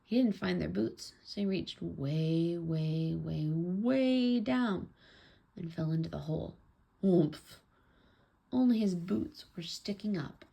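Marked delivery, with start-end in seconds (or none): 2.6-4.9 each "way" drawn out, the last biggest; "way, way, waaay down", slow and building; stretched WAY. A narrator's reading. Father reaches deep into hole. 7.0-7.6 sudden narrated impact grunt; short, loud storybook thud noise; loud oomph. A narrator's reading. Father falls into the hole.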